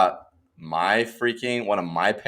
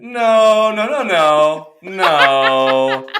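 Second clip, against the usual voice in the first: laughing no; 7 semitones higher, 8 dB louder, much slower than usual. Real, not acted. Laughing refusal.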